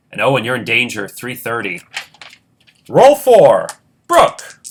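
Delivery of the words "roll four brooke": loud, high call to the next player; game host calling the next turn. Real, not acted. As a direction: announce the new round with a high shout. Host calls out next game round.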